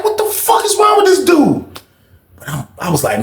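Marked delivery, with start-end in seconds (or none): 0.0-1.6 high, stunned question; shocked disbelief. Retold or imitated. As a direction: teller stunned by the cellmate, asking high. Shocked reaction.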